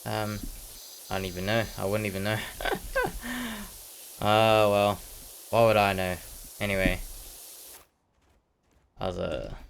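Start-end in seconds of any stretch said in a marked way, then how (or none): 2.4-3.7 high, soft giggle; real giggle at oneself; amused laugh. Real, not acted. Laughing at not knowing the date, amused high giggle.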